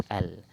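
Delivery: "al" with a clearly sounded L. Shown as a demonstration. Teacher showing students a sounded lam, a clear L in "al". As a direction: sound the L distinctly in "al". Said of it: "kita sebut lam tu dengan jelas".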